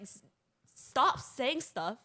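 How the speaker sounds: ordinary delivery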